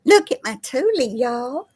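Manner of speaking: high, proud cooing voice. Real, not acted. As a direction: show off the cat, up high. Showing cat proudly.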